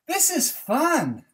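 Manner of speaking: high, loud, slow joyful cry; excited animals. A narrator's reading. Barnyard animals riding bikes, high happy cry. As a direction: cheer it out with delight. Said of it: "they all said".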